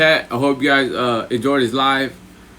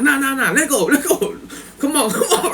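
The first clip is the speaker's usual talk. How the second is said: high, laughing impression of insisting; mimicked insisting; 11 semitones higher than usual. V waving off his broken pinky, mock insistence through laughter. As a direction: imitate him urging everyone on, high. Retelling V insisting play continues.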